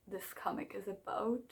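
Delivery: whispered oops aside. Real, not acted. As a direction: drop to a whisper as you catch yourself. Realizing she forgot to explain.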